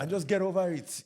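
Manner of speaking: high, loud dismissive elder impression; hammed-up elder scolding the young; mock older generation. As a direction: put on an indignant old-timer scoffing. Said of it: "older generation, mental health don't exist".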